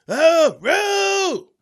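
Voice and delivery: two loud high howls; heckler noise. Retold or imitated. Heckler answering the host, loud wordless howls. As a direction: howl nonsense like a drunk heckler. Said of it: "Just making noises".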